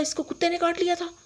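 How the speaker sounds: high-pitched, startled echo of the news; retold shock, pitched sharply high; shocked question. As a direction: repeat it back in disbelief, voice jumping way up.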